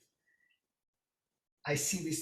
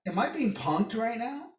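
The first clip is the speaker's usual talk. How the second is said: much higher, disbelieving am I being punked; incredulous; 11 semitones higher, 5 dB louder than usual. Retold or imitated. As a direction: ask in shocked disbelief, pitch climbing high. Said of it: "And I'm like".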